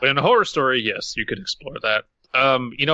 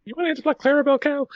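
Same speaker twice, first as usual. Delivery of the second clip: high squeaky cartoon mouse, angry; angry falsetto cartoon mouse; Mickey Mouse voice; 13 semitones higher, much faster than usual. Voicing Mickey fast.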